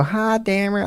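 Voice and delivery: mock high squeak. Shown as a demonstration. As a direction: flip into a silly high voice. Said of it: "I can go".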